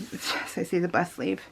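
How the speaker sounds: usual speaking voice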